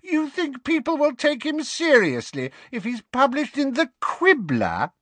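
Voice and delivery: high, cackling disbelief; mocking high cackle. A narrator's reading. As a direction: laugh through the question.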